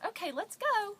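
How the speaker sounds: high and loud, imitating an embarrassed parent; hurried cheerful exit. Mother getting her three-year-old away, high loud urging.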